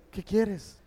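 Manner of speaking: soft, merciful and quiet; tender question. Retold or imitated. Jesus gently asking the leper what he wants. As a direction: ask with mercy. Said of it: "con amor y misericordia".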